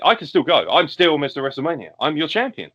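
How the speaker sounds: lightly voiced cocky champion; Shawn Michaels promo. Retelling Shawn's boastful promo.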